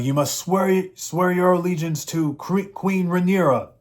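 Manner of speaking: a hint of a stern messenger making demands; imitating a herald. Messenger demanding allegiance to Rhaenyra, lightly voiced herald.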